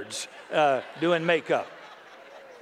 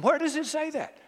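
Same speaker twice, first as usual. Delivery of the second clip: pitched way up in disbelief; indignant question; 10 semitones higher than usual. Real, not acted. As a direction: challenge them, incredulous. Indignant rhetorical challenge.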